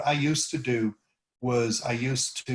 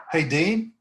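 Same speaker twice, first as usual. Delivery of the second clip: higher, louder hail that climbs on "Dean"; rising intonation on the name; 4 semitones higher, 5 dB louder than usual. Shown as a demonstration. Coach repeats the cold-call opener, rising intonation. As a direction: lift "Dean" up as if greeting someone you know. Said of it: "you always go up on the name".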